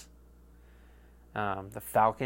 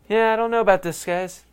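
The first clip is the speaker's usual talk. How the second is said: mock doubtful fan; 8 semitones higher, 5 dB louder than usual. Doubtful fans turning on team.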